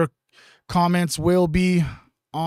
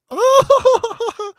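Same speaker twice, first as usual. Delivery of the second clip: high loud burst of laughter; 6 dB louder than usual. Real, not acted. Laughs at chat message.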